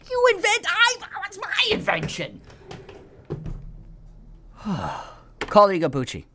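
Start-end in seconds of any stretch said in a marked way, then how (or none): 0.1-2.4 outraged squeak. Retold or imitated. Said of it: "I'm like".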